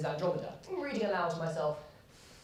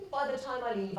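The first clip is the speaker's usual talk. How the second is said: ordinary talk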